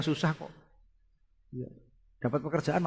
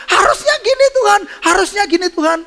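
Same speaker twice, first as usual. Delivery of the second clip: loud, high whiny complaint; mock a believer dictating to God; protesting to God; 14 dB louder than usual. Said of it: "sering dikte Tuhan". (protes merengek lantang)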